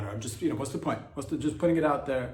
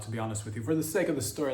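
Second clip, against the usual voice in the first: ordinary delivery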